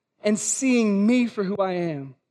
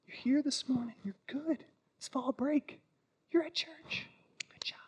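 soft, soothing devil whisper; Satan's whisper; 4 semitones higher, 14 dB quieter than usual. Acted. Preacher voicing Satan coaxing churchgoers back to sleep, hushed whisper. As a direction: lull them back to sleep in a hush.

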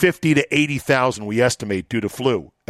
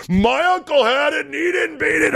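sudden shout. Acted. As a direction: break the pattern with a high yell. Punchline after calm list.

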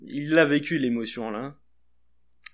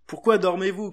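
louder, higher rebuke; cried out; 5 semitones higher, 6 dB louder than usual. Acted. Jesus finding his sleeping friends, calling out louder. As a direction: wake them with a sharp call. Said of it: "cria-t-il".

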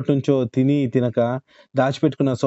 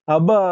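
sharp ouch after a fall, higher and louder; pained cry; 5 semitones higher, 4 dB louder than usual. A narrator's reading. Dhanayya falls in the stream, cries out in pain. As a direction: cry out as the ankle twists. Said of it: "ముక్కుతూ మూలుగుతూ".

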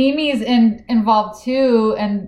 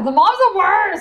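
pitched way up in exaggerated disgust; mock outrage; 10 semitones higher, 3 dB louder than usual. Real, not acted. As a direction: squeal it like the mom is unbearable.

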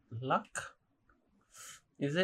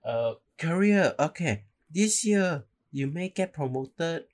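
put-on Uncle Roger accent; 8 semitones higher, 4 dB louder than usual. Fortune-teller predicting a promotion, mock uncle accent. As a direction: pronounce the verdict high and slow in a mock uncle accent. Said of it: "now I'm sounding like Uncle Roger".

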